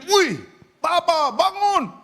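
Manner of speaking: very high, loud alarmed cry; acted panicked wife calling out; startled wife. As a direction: shriek to wake a sleeping husband. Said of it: "Istrinya kaget".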